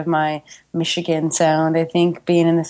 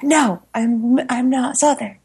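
higher-pitched retold denial; playful, pitched-up objection; protesting; 7 semitones higher, slower than usual. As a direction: insist against the label, mock indignant. Retold protest about her speech.

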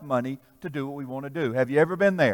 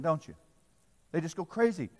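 ordinary talk

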